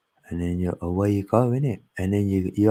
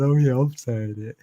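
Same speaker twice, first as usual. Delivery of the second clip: laughing; 5 semitones higher than usual. Retold or imitated. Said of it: "And then I was laughing".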